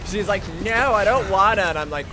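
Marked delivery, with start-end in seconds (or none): 0.6-1.6 higher, louder whiny refusal, mimicking her; mock protest. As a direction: imitate her refusing, pitched up and loud. Voicing game character's refusal.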